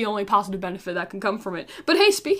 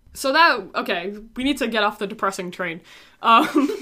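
normal talking voice

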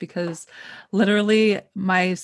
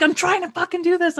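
mock frustrated snap; 11 semitones higher than usual. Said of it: "He's like".

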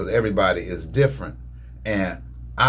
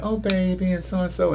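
put-on higher, syrupy "Oh, baby"; mock sugary baby talk; 9 semitones higher than usual. Husband mimicking his wife's sugary TV endearments. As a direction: coo it like a TV sweetheart. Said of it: "if you're like".

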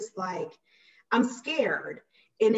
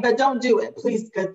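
mock inner plea; 3 semitones higher, 6 dB louder than usual. Inner plea not to hit.

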